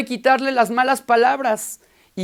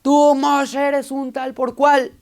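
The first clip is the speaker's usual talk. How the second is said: parrot yelling insults; 4 semitones higher, slower than usual. Acted. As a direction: hurl the insult at him. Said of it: "más le gritaba y le gritaba de groserías".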